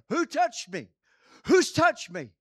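loud, urgent shout into the crowd. Acted. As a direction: bellow it out so the whole crowd hears. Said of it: "And he would have shouted it".

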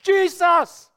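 loud, high shout of a holy name. Shown as a demonstration. Preacher shows the congregation shouting the name, shouted. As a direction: shout the name out with full force. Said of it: "or we shout his name".

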